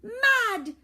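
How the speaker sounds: ordinary delivery, nothing marked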